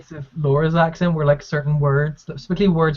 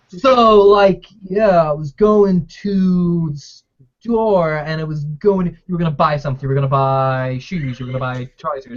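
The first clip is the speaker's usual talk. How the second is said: drawn-out slow Virginian speech; imitated slow talker. Imitating a friend from Virginia, very slow speech. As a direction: stretch the words out like a slow-speaking friend. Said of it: "he spoke so slowly".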